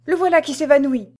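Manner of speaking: high, loud feigned panic; alarmed cry. A narrator's reading. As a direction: cry out to the servants in mock alarm. Dubois cries out that he faints.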